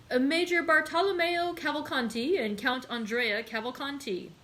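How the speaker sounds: a servant's formal announcement; lightly voiced butler announcing arrivals; announcing guests. A narrator's reading. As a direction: call out the names to the room. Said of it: "announced Baptistine".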